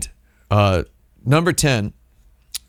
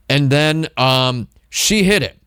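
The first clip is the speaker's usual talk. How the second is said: ordinary delivery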